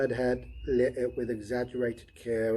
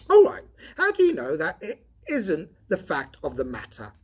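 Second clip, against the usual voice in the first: higher, louder voice for the redhead Donovan; Donovan voiced higher; 8 semitones higher, 12 dB louder than usual. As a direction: lift into the tired redhead's voice.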